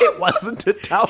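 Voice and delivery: high, loud, laughing emphasis; gleeful laughing correction. Real, not acted. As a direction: laugh while insisting. Laughing reveal.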